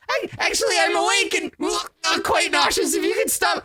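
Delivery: very high squeaky creature voice; squeaky Lulu. Acted. DM voices Lulu, tossed in the air, high squeaky and queasy. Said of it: "Lulu's like".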